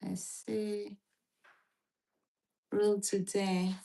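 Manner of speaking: ordinary delivery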